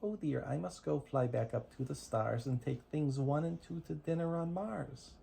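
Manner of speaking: normal talking voice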